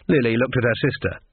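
usual speaking voice

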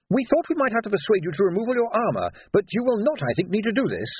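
high-pitched, precise character voice; fussy technician Q. A narrator's reading. Q telling Vimes about his armour, high and fussy. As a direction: explain it fussily in a high voice.

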